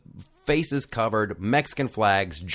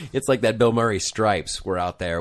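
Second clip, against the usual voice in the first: normal talking voice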